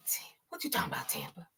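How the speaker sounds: breathy whisper, fast, trailing off; whispered tease; whispered catchphrase. Pretend. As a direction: whisper it teasingly at Tampa. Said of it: "playful catchphrase aimed at Tampa".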